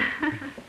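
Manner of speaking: short high nervous laugh; a quick anxious chuckle. Acted. Nervous laugh after joke.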